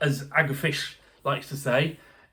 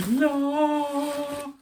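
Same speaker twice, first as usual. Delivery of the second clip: high delighted no; excited squeal; 11 semitones higher than usual. Real, not acted. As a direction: squeal it with joy. Guitar coming out of box.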